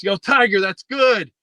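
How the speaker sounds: high, loud heckler's call across the green; imitated yell. Friend yells to Tiger on the putting green, loud heckling shout.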